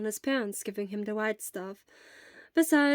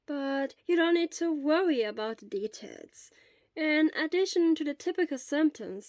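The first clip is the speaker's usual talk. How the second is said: lightly voiced elderly nurse; 6 semitones higher than usual. Elderly school nurse gently questions a feverish boy.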